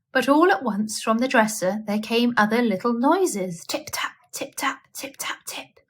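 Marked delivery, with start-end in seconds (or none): 3.7-5.8 soft tapping sounds. A narrator's reading. Mice tapping on the dresser.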